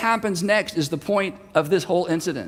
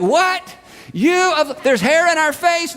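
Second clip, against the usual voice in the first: confused yell; 9 semitones higher, slower than usual. Retold or imitated. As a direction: holler back, unable to hear. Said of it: "they're like".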